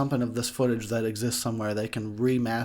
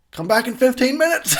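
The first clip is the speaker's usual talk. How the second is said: louder, higher shouted order through a laugh; mock yell; 12 semitones higher, 8 dB louder than usual. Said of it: "I would yell".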